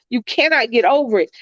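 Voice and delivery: ordinary talk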